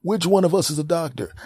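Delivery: high, chirpy doctor voice; high cheerful doctor asking questions; high mock doctor. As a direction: breezily quiz the caller.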